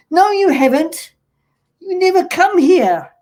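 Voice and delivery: loud, high, indignant protest; upset retort. Retold or imitated. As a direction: protest loudly, like a hurt fan. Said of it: "I got quite a little bit upset".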